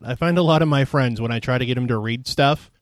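ordinary talk, nothing marked

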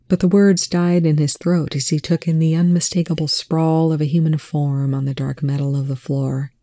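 ordinary delivery, nothing marked

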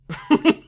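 short high laugh; burst of laughter. Real, not acted. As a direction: laugh out loud at the joke. Laughing at own quote.

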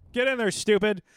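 lightly voiced sergeant hurrying recruits along; imitated drill sergeant. Veteran imitating sergeants cramming privates into cattle cars, mildly bossy.

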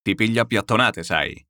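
a hint of a gruff courier threatening; mild threat. Courier threatening the carriage crew, mild menace. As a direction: threaten him, understated. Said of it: "minacciò un corriere".

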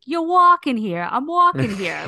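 loud mock New York accent, walking here; hammed-up New York accent imitation. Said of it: "my kids doing New York accents".

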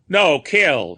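loud, high protest; a frightened foreigner's loud denial; exclaimed. A narrator's reading. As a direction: deny it with all your force. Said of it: "he exclaimed".

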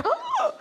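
very high, startled "huh"; hammed-up high squeal of shock; shocked gasp. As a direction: gasp in exaggerated disbelief. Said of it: "Like, I was shocked".

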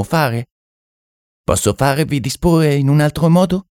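high-pitched mystic, stretching key phrases; French medium. Acted. French medium explaining that thoughts are things, high and assured.

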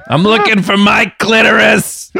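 high, drawn-out barroom shout; Mo the bartender. Pretend. Bartender asking the room for a prank name, mock bartender shout. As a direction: call out a prank name across the bar. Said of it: "Fucking Mo the bartender over here".

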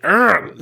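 frustrated growl from behind the teeth; angst-filled growl. Acted. Jesus exasperated with the religious leaders, angst-filled growl. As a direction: growl "urgh" through gritted teeth. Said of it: "back of the teeth kind of like".

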